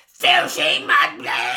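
high, loud, slow elf screech; mock house-elf screeching an insult; house-elf impression. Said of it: "and then he goes".

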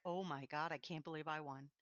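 low, very soft, as if to yourself; retold private murmur of amazement; hushed disbelief. Winner recalling Tuesday morning's discovery, hushed disbelief. Retelling waking up to the win.

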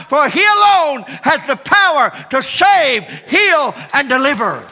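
loud, high preaching climax; full-force preaching. Acted.

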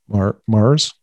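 Mars with a questioning rise; a careful sample of Mars; saying Mars on request. Shown as a demonstration. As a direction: try out the word Mars as asked. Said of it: "if you say Mars".

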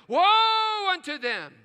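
loud, high, slow proclamation of woe; ringing warning. Real, not acted. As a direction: cry it out like a prophet. Quoting scripture's warning.

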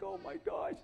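high, small squeal of dismay; mock dismay. Preacher reacting to God's costly request, high mock dismay.

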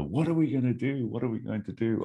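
half-hearted retold yell, falling away; mock yelling. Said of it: "Gorbachev is yelling at Reagan".

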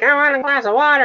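high, put-on child voice; whining kid. Dad imitating his kids' demands, high child voice. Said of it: "the kids are gonna be like".

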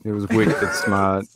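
mock Boston accent. Friend quoting a movie, slow mock Boston accent. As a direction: draw it out in Bostonese.

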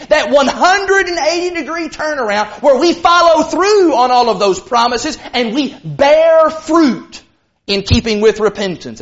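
usual speaking voice

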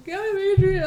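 put-on high, loud childish "I'm Adrian"; a kid inventing a fake name; high squeaky fake innocence; squeaky kid fib.

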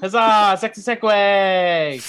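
loud, high, drawn-out hurrah; mock-triumphant cheer. Host cheering the segue to final thoughts, mock triumph. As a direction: celebrate it like a playful fanfare.

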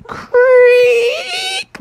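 very high drawn-out creak; high squeaky door creak. Acted.

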